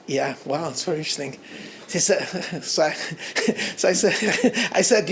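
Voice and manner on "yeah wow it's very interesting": polite. Retold or imitated. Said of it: "And I'm very polite".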